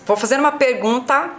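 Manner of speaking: ordinary delivery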